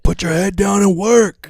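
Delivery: loud, high mock hard-ass yell; mock macho shout. Co-host voices a macho hard-ass, mock shouting. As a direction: bellow it like a hammed-up tough guy. Voicing a macho boss's tough talk.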